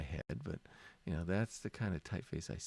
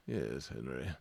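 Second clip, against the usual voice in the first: prim butler voice; 8 semitones lower, 4 dB quieter, slower than usual. Acted. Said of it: "They're all prim and proper".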